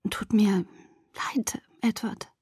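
slow unvoiced whisper. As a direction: breathe the apology out softly. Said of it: "flüsterte ich".

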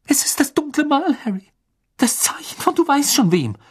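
frightened. A narrator's reading. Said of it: "bestürzt in ihr weißes, verängstigtes Gesicht".

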